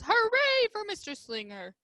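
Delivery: high, bright hooray; cheering. A narrator's reading. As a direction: cheer it like mice in a picture. Cheer read from the book.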